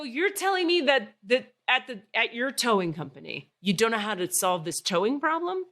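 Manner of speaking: angry. Retold or imitated. Retold angry confrontation with tower.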